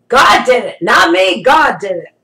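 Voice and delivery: forceful, punched short phrases; emphatic testimony. Real, not acted. Crediting God for the long wait, emphatic. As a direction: drive each phrase home loudly.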